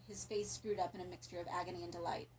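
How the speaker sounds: usual speaking voice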